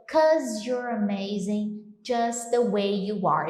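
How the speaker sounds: slow, spoken lyric; slow song lyric. Shown as a demonstration. Teacher reciting a love song to students, slow. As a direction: savour the lyric slowly. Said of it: "Ele continua".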